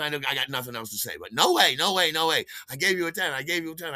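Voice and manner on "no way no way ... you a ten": raised, rapid-fire protest, easing off; retold screaming. Buyer repeating himself at the dealers, mock screaming. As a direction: imitate screaming it over and over, fading. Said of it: "I just start screaming it and screaming".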